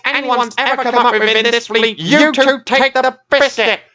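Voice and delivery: high, loud furious tirade; raging. A narrator's reading. Captain Zero rages at his two useless tugs, loud and high. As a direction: pile on the insults in fury. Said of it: "raged Captain Zero".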